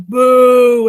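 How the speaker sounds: long booed call; loud boo. Shown as a demonstration. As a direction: boo like a heckler. Audience booing demo.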